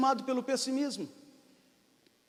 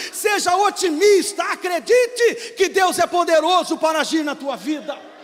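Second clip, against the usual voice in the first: higher, louder fervent preaching; preaching at full force; 7 semitones higher, 5 dB louder than usual. Acted. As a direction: build to a rousing altar call. Preaching climax.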